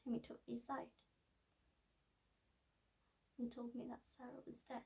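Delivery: very soft, subdued telling; hushed grief. Acted. Daughter recalling the news of her best friend's death, hushed grief. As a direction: drop the voice for terrible news. Recounts learning friend is dead.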